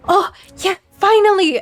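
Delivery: gasp, then high-pitched relief; excited. Acted. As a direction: gasp and exclaim excitedly for the characters. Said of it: "Port and Bella excitedly are like".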